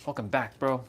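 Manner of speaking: normal talking voice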